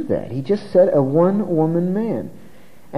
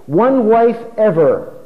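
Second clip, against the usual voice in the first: "One wife ever" slow, higher and louder; emphatic restatement; 6 semitones higher, 5 dB louder, slower than usual. Real, not acted. Preacher spelling out view number five, "One wife ever" said emphatically.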